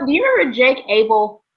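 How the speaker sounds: usual speaking voice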